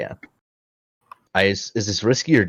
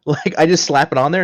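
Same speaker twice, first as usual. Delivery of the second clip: usual speaking voice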